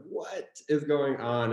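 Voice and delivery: slow, drawn-out amazed question; disbelieving. Retold or imitated. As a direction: stretch it out in mock disbelief. Retold disbelief at going viral.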